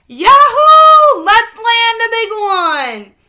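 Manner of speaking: huge high whoop, slow and booming; way too loud. A narrator's reading. Holler whooping in Gramps' boat, far too loud. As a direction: yell it across the whole lake. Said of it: "his words came out too loud".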